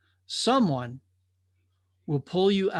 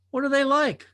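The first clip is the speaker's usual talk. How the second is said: put-on eager young drifter; 9 semitones higher, 4 dB louder than usual. Drifter asks about the falls.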